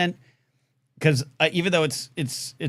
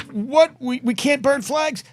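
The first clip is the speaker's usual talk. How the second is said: mocking outrage; 12 semitones higher, 4 dB louder, slower than usual. Mocking a news headline.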